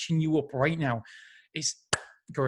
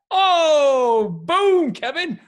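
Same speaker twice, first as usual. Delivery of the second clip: loud, high whoop of delight; cheering for Kevin Hart's advice, loud and high; excited cheer; 11 semitones higher, 10 dB louder, much slower than usual. Real, not acted. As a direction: shout it like a fan celebrating. Cheering the book's lesson.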